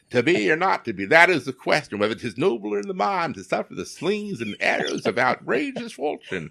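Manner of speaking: parodying a southern Shakespeare festival's Hamlet, hammed-up and soaring; hammed-up Shakespearean actor. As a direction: overact the soliloquy like a bad festival player. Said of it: "the Georgia, you know, uh, Shakespearean festival".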